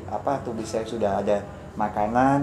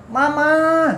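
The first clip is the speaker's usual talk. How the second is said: high, loud drawn-out yell; teriakan sangat kencang; 7 dB louder than usual. Retold or imitated. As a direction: holler for mama as loudly as possible. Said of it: "suara yang sangat lebih kenceng banget". (teriak sekencangnya memanggil mama)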